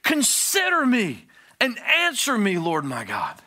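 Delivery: loud, high desperate cry; shouting, pleading prayer with a high pitch; screaming plea. Shown as a demonstration. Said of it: "This is screaming".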